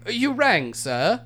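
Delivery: a lightly voiced butler answering the bell. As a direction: snap to attention like a servant. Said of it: "Dickie Wicky entered the room".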